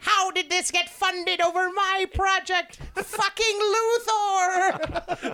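high, very slow furious cartoon villain. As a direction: wail like a snubbed supervillain. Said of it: "he's just like".

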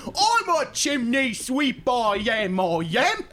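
high, slow mock Cockney accent; bad movie Cockney accent, high and drawn out. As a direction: do a hammed-up fake Cockney chimney sweep.

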